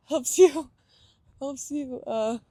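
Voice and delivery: laughing through "helps you" after losing her thread; a giggly, embarrassed stumble; flustered laugh. Real, not acted. Speaker losing her train of thought mid-talk, laughing. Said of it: "oh man, I just lost my train of thought".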